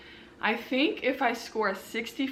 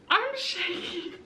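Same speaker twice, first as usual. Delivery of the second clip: shaky laugh; 7 semitones higher, slower than usual. Real, not acted.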